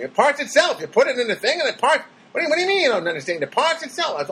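high, fast exasperated dad voice; irritated dad. Retold or imitated. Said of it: "seemed a little irritated with me".

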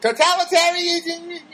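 high, drawn-together goofy comic voice; Jerry Lewis. Acted. Comedian turning a hard word silly, Jerry Lewis impression. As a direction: squeal the word like a zany comedian. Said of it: "that's like a Jerry Lewis word".

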